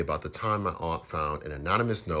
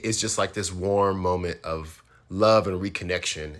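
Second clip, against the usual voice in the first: plain reading voice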